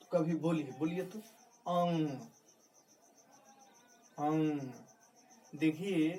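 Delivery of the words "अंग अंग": "अंग" said with a held nasal hum; nasal demonstration. Shown as a demonstration. Teacher showing students the nose's role, nasal "अंग". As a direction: sound out "अंग" through the nose. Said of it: "नाक से जो है इसका उच्चारण हो रहा है".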